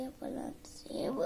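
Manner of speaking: barely audible whisper of the answer. Real, not acted. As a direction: mumbles it under his breath. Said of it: "जोर जोर से बोल". (फुसफुसाकर)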